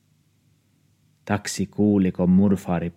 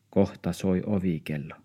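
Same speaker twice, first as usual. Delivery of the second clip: plain reading voice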